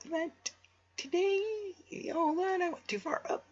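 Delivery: playful high-pitched voice; silly high voice. Acted. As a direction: squeak it out for laughs. Silly high voice while streaming.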